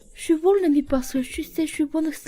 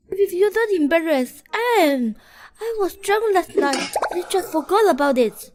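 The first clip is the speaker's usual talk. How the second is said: raised, higher-pitched protest; flustered indignation; 5 semitones higher, 3 dB louder than usual. Acted. Embarrassed woman excuses last night's drinking, flustered and indignant.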